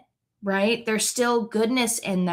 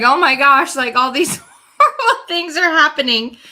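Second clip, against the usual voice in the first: mock-despairing father, higher and louder with a laugh; distraught old father; 6 semitones higher, 8 dB louder than usual. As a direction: ham up poor Jacob's dismay while chuckling. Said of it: "he's like".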